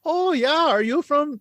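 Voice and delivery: high-pitched, happy mock Canadian; terrible Canadian accent. Host mimicking nice Canadians, terrible Canadian accent. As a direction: badly fake a cheery Canadian accent. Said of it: "that's a terrible accent".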